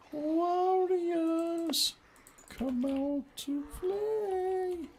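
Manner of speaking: a creepy falsetto taunt; high creepy singsong. Pretend.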